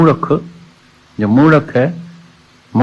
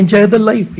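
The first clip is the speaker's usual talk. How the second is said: mocking worldly advice; 11 semitones higher, 5 dB louder, much slower than usual. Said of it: "कई बोलते हैं".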